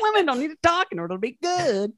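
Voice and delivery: high-pitched mock-sexist voice; mocking imitation. Comic repeating club's sexist reasoning for herself, mockingly. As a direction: parrot it back in a silly high voice.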